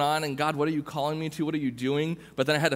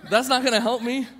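much higher, louder, laughing; laughing protest; 10 semitones higher, 6 dB louder than usual. Retold or imitated. Repeating the protest to God, higher and laughing. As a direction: laugh it out in disbelief.

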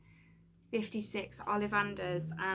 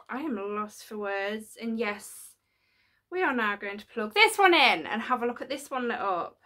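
ordinary talk, nothing marked

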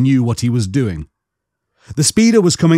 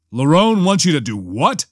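mildly indignant question into a comlink; incredulous demand; 2 semitones higher than usual. A narrator's reading. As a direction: demand to hear that once more. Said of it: "Han demanded into his comlink".

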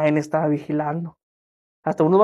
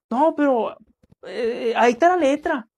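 mock pushy client; 6 semitones higher than usual. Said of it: "y haces esto".

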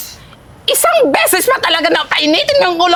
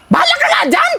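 shouting; 10 semitones higher than usual. Acted. Storming off mid-quarrel.

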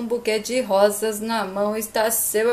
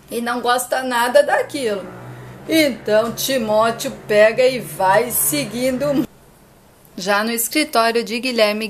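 ordinary delivery, nothing marked

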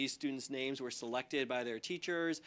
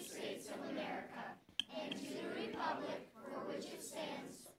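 teacher voice; 11 semitones higher than usual. Real, not acted. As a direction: lead the class in the pledge. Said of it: "I use my teacher voice".

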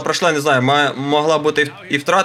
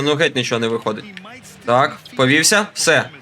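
ordinary delivery

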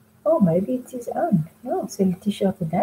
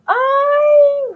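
a sudden very high, louder "ay"; startled cry; 5 dB louder than usual. Acted. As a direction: jump at being caught red-handed. Danny startled by brother returning.

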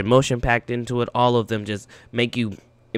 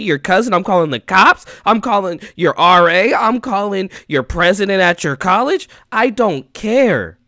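raised, insistent pitch, listing each call; emphatic refusal; 5 semitones higher, 5 dB louder than usual. Pretend. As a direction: vow it to a friend, fired up. Vows to call everyone for friend.

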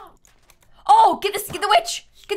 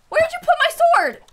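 high, louder demand; indignant demand; 9 semitones higher, 4 dB louder than usual. Real, not acted. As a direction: demand the sword back up high. Demanding sword back.

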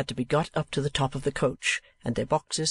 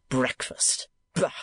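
testy scorn; slower than usual. A narrator's reading. Uncle dismissing talk of breakfast, testy scorn. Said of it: "said Ralph with great testiness".

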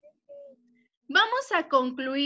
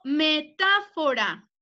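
slow dictation; slower than usual. Shown as a demonstration. Teacher giving the last word for checking, slow dictation. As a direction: say "metáfora" slowly, stretching the second syllable.

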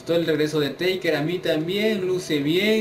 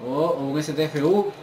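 normal talking voice

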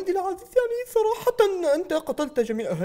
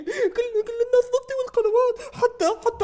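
higher, crying complaint; whining cry; 8 semitones higher than usual. Acted. Leader crying to his father, whining cry. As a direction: whimper like a spoiled child.

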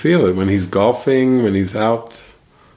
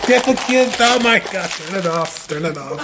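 high, laughing imitation of alarm; hammed-up panic dissolving into laughter; mock panicked viewers; 10 semitones higher than usual. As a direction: play horrified parents, voice pitched right up. Voices shocked viewers at home, laughing.

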